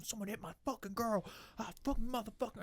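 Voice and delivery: high, held-back mock raging voice; agitated man ranting. Man pacing by his car, agitated mock rant. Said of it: "He's pacing around. He's going".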